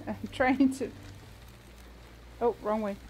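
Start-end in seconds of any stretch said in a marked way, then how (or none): none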